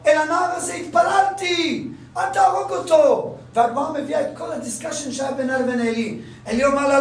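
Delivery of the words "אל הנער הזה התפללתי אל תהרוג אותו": higher and slower, a mother's retold plea; mock pleading cry. Hannah begging Eli to spare her son, pleading high. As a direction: beg for the boy's life, stretched out. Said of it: "מתחילה לצעוק".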